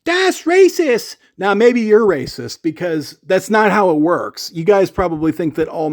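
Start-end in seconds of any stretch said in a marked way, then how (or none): 0.0-1.2 much higher, slow mocking whine; mock outrage. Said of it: "anybody's like".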